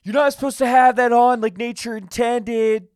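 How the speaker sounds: high, furious shout; yelling. Pretend. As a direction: yell it in outrage. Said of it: "Just yelling at people".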